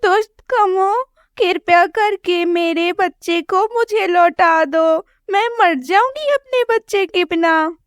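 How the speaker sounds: mother sparrow pleading through mild tears; crying mother bird. Acted. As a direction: beg the crows for your baby. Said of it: "और रोने लगती है".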